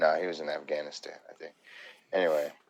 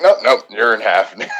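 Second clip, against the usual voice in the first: louder, higher menacing quip with a laugh; mock Homelander; 4 semitones higher, 14 dB louder than usual. Fan quoting the superhero villain, much louder. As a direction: toss off the villain's line. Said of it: "it's just like".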